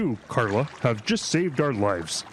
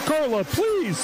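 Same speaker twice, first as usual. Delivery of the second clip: pleading; 13 semitones higher, slower than usual. Acted. Pleading during panic.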